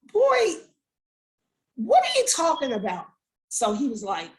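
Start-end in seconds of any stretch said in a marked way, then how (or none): 0.1-3.0 high exclamation, then a pause and falling question; acted exasperation. Mother questioning her son's story, exasperated. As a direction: play the mom who has had enough.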